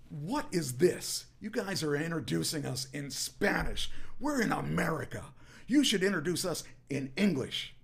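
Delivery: crowd-baiting heel promo. Retold or imitated. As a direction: rile the crowd with loud, high outrage. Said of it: "knowing how to incite a crowd".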